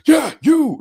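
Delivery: loud, high burst of recognition; excited recognition. Retold or imitated. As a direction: shout it out like you just placed the face. Costner suddenly recognizing him.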